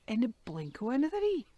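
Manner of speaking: ordinary delivery, nothing marked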